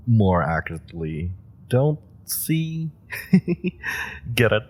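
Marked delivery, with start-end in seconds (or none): 3.1-4.3 breathy giggle. Acted. Quiet laugh after her own pun.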